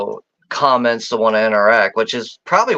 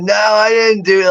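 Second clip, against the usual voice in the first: mock mom denial; 9 semitones higher than usual. Mom's denial voiced.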